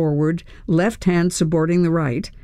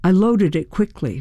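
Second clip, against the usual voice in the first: ordinary delivery, nothing marked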